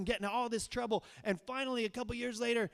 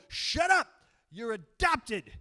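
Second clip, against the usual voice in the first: put-on much higher, louder teen snapping, slowing on adopted; retold angry yell; 5 semitones higher, 9 dB louder, slower than usual. Teen shoves little brother off the Nintendo, mock angry yell. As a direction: bark it at the kid grabbing the remote.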